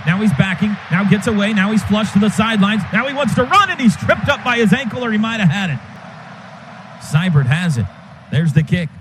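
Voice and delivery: usual speaking voice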